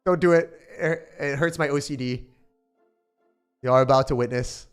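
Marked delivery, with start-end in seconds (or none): none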